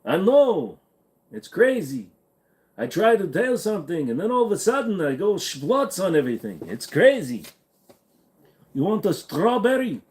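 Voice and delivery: high, hammed-up Yiddish-style accent.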